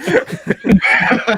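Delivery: shrieking high burst of laughter; squealing real laughter; loud high laugh. Real, not acted. Laughing at the cute Batman bit.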